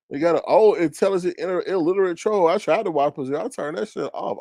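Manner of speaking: ordinary talk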